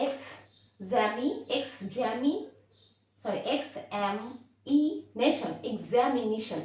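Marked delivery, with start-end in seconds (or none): 0.1-5.5 x, এম, ই, nation as separate beats; split into four parts. Shown as a demonstration. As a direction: break examination up, pausing between chunks. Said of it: "চারটা ভাগে ভাগ করে". (চার ভাগে ভাগ করে, থেমে থেমে)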